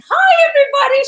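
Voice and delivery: squealing high, loud hello; very high excited greeting. Retold or imitated. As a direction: pitch it way up like an overexcited host. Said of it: "she talks very high when she gets excited".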